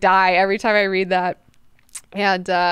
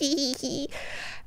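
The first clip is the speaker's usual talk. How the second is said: high, softer put-on giggle; mock giggle; 6 semitones higher, 11 dB quieter than usual. Leto pleased with his secret, mock giggle. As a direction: titter slyly in a silly voice.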